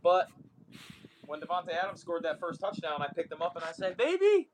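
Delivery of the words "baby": high, loud sing-song cheer to an infant; over-the-top excited daddy voice for the baby; excited baby talk. Retold or imitated. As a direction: squeal the news up high to the baby like it's the best thing ever. Dad cheering touchdown to his baby.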